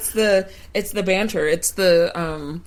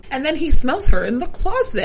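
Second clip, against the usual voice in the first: loud, higher-pitched interjection; excited outburst; 5 semitones higher, 10 dB louder than usual. Real, not acted. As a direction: burst in loudly, pitch up. Co-host bursts in about scene.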